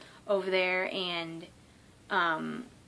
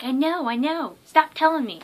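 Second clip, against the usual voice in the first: higher, louder imitation of an impatient mom; exasperated mom; 6 semitones higher, 6 dB louder than usual. Mom tired of daughter's reminders, exasperated. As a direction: cut off the nagging, pitched up.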